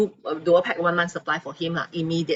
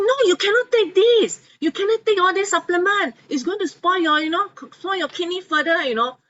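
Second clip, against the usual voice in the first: stern doctor; 12 semitones higher, 5 dB louder than usual. Retold or imitated. Doctor forbids the supplements.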